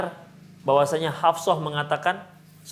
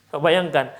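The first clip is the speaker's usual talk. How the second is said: ordinary delivery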